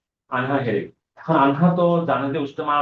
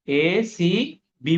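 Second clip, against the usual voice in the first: slow spelled-out name; 2 semitones higher, much slower than usual. Shown as a demonstration. Teacher slowly spells out new angle name.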